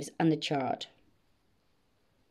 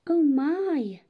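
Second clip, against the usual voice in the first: higher, falling exclamation of dismay; sighing dismay; 7 semitones higher, 4 dB louder than usual. A narrator's reading. Dragon spots sheep in the mud, sighing dismay. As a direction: sigh it out in dismay. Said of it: "Then he stopped with a sigh".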